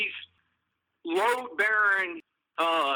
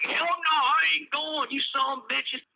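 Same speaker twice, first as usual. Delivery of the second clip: put-on drunk yelling refusal; 7 semitones higher than usual. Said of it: "He's like".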